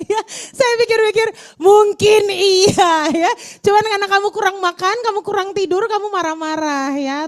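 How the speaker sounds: half laughing at the thought; amused realisation. Real, not acted. Laughing realisation about sleep. (tertawa geli menyadari)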